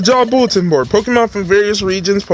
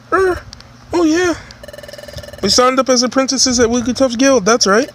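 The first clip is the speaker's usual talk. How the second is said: much higher, hurried panic; panicked partner voice; 7 semitones higher than usual. Acted. Partner realizing they overslept, high and panicked. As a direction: squeak it out in alarm.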